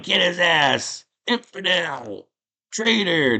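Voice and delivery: high, slow, drawn-out crowd yells; mob yelling. Retold or imitated. Crowd yelling as they drag Conan off, slow furious shouts. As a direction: bellow it like an angry mob. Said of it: "everybody's yelling".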